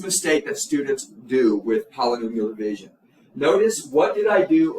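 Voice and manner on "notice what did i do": sharp, louder "Notice," to grab the class; warning teacher's "Notice,"; emphatic call for attention. Shown as a demonstration. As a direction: snap their attention onto the board. Teacher flags a common mistake.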